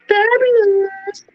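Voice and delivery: very high, drawn-out and flamboyant; campy fabulous. Retold or imitated. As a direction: stretch it out in a high, showy voice. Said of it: "Or in other words".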